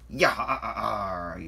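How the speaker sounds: loud, drawn-out pirate yarr; laughing pirate. A narrator's reading. Salty laughs behind the boxes, pirate voice. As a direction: roll out a chuckled pirate growl. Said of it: "laughed a piratey voice".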